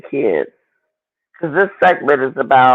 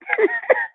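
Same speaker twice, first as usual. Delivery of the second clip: high squealing laugh; 4 dB quieter than usual. Real, not acted. Laughing at the show.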